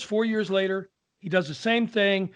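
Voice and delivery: plain reading voice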